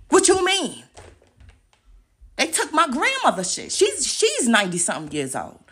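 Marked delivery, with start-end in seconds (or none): 0.1-0.8 higher, loud, disbelieving; incredulous shout. Real, not acted. Ranting at critics online, even higher disbelief. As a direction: throw it back louder.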